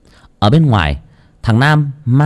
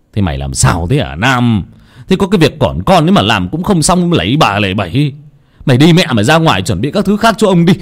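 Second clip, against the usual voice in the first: angry scolding; 3 semitones higher than usual. Acted. Father yells at clumsy servant Nam, fast angry scolding. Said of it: "mà quát".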